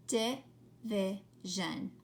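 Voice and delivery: "Division" slowly broken into three separate beats; syllable by syllable. Shown as a demonstration. Teacher counting syllables for pupils, word broken apart slowly. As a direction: count out "Division" with a gap after each syllable. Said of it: "How many syllables?".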